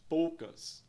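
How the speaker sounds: ordinary talk, nothing marked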